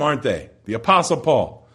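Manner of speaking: ordinary talk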